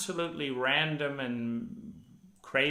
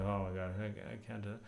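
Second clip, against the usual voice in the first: tired inner voice; 2 semitones lower, 9 dB quieter, faster than usual. Retold or imitated. Voicing own exhausted inner thoughts.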